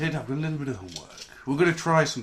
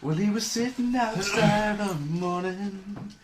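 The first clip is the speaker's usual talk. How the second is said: slow, higher storyteller voice; storybook narration; 5 semitones higher, much slower than usual. Acted. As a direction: read it like a slow bedtime tale.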